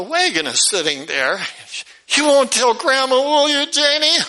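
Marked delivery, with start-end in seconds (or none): 2.1-4.2 put-on high, begging child voice; pleading little boy. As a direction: coax her not to tell. Boy begs sister to keep secret.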